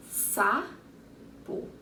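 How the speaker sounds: "Sapo" split as sa, po with a pause; slow two-beat "Sapo" for counting; syllable by syllable. Shown as a demonstration. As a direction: say each half of "Sapo" separately. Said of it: "Duas sílabas".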